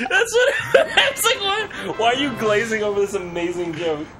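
ordinary talk, nothing marked

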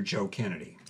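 plain reading voice

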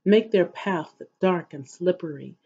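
normal talking voice

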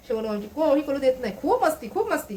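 cutesy sing-song cooing, pitch swooping up. Real, not acted. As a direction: coo a fond mock complaint to a pet. Cooing playfully at her pet.

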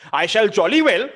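loud, high sarcastic jolly well; angry sarcasm. Acted. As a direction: spit "I shall jolly well" loud and bitter. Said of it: "he's very angry".

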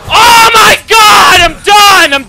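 loud, high furious outburst; raging. Real, not acted. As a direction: scream it in real frustration at the game. Raging at the game.

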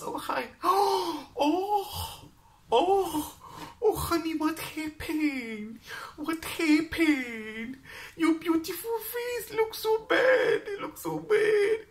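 put-on very high, slow wailing Chinese accent; strong Chinese accent. Chinese stall owner wailing over her face, high Chinese accent. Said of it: "that strong Chinese accent".

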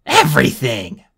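loud anguished shout. Acted. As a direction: shout the single word. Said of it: "he shouted".

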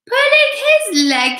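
very high, loud, drawn-out squeal; gleeful cry. A narrator's reading. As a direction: squeal it out in mock-outraged glee. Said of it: "he cried, wriggling with glee".

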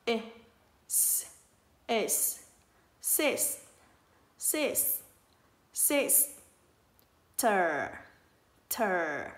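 "s", "sis", "ter" as separate sounds with long pauses; đánh vần từng âm. Shown as a demonstration. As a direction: sound out "sis" and then "ter", one piece at a time. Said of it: "Ở đây mình có âm s". (tách riêng "s", "sis", "ter", ngắt giữa các âm)

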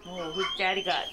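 quiet, tender pet talk. Real, not acted. Soft talk to newborn animal.

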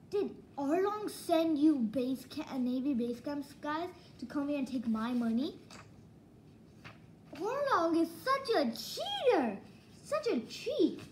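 high, indignant outburst building as it goes; high-pitched mock fury; acted outrage. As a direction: cry out betrayed, acting it up. Acts out Nami's outrage at betrayal.

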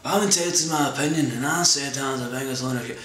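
mock the guy's self-righteous tone; a lightly voiced pompous guy; smug heckler. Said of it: "And he's like".

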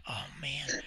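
whispered, hushed in awe; awed whisper. Retold or imitated. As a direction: breathe it out in wonder. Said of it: "I said".

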